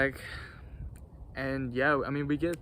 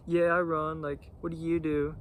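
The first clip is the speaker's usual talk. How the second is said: higher mock-snobby voice; lightly voiced entitled runner; snooty runner; 3 semitones higher than usual. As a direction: imitate a smug runner showing off.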